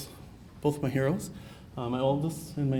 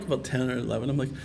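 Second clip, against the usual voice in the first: ordinary talk, nothing marked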